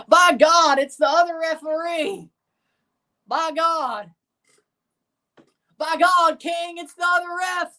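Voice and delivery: high, loud strained wrestling-commentator cry; Jim Ross. Acted. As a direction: badly imitate an overwrought wrestling announcer. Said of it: "that's a really bad J R impression".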